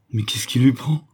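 mildly exclaimed question; acted bewildered outcry; alarmed exclamation. A narrator's reading. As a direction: cry out in another person's voice. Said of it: "clama Suzon".

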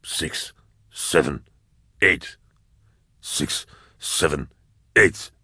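put-on old man counting under his breath, quiet and slow; a scarred veteran counting shells to himself; a hint of a broken old soldier muttering; mumbled counting. A narrator's reading. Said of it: "counting each time".

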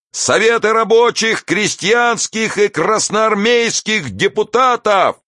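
high-pitched, slow oratory with drawn-out words; stretched, ringing public speech; impassioned street orator. A narrator's reading. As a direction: harangue the crowd at a high pitch.

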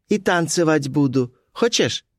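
put-on high girlish offer; Colibri's voice. A narrator's reading. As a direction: rise very high on the closing question.

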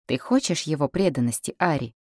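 plain reading voice

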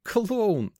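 high, drawn-out teasing exclamation; amused outburst. A narrator's reading. Girl teases her companion, amused high outburst. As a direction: blurt it out on the edge of a giggle. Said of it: "прыснула она".

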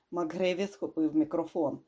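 plain reading voice

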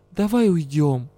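ordinary delivery

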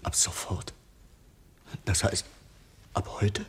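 a whispered, winded echo of the bad news; shocked whisper. Dismissed guard whispers back to his boss, stunned. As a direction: breathe it out in stunned disbelief.

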